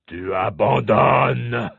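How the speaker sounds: slow, drawn-out dragon question. A narrator's reading. Dragon questions the exhausted knight, slow dragon voice. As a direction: ask it as a dragon, stretching every syllable. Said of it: "demanda le dragon".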